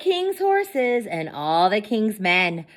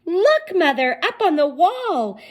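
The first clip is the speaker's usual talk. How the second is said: high, loud alarmed call; hollering; 11 semitones higher, 3 dB louder than usual. A narrator's reading. King Mo hollering to his mother about the egg, high and loud. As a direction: shout to your mother, pointing up. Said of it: "Mo hollered".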